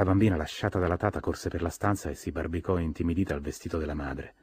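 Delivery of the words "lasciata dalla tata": ordinary delivery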